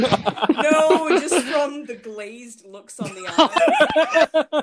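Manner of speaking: laughing through the words, loud and slow; loud giggly answer. Real, not acted. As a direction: crack up while answering. Laughing about stoned audience.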